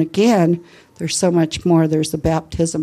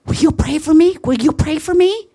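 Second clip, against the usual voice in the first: imitating an eager, higher plea; 8 semitones higher than usual. Caller asking her to pray for him, mildly excited. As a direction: beg for prayer, lightly excited. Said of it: "I could feel the excitement".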